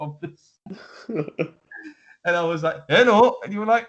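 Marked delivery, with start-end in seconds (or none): 2.9-3.4 high, loud mock Spanish accent; botched German manager turning Spanish; bad Spanish-sounding accent. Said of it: "Spanish Tuchel".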